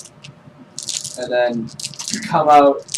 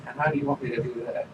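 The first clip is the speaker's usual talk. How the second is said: ordinary talk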